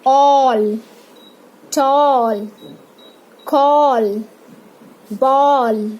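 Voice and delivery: slow separate words with drawn-out aw vowel; long o demonstration. Shown as a demonstration. Teacher shows the longer sound, All to ball with lengthened vowels. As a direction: stretch the o in All, tall, call, ball. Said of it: "ये थोड़ा लंबा खींचते हैं".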